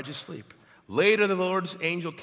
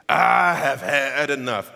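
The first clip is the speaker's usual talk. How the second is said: put-on southern accent; 9 dB louder, slower than usual. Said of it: "Elijah had a southern accent".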